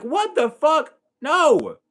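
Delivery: high-pitched, loud retold disbelief; outraged refusal. Child told he's getting baptized, outraged refusal. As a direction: exclaim in shock, then a loud high no.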